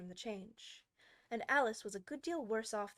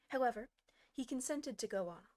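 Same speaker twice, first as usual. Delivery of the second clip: plain reading voice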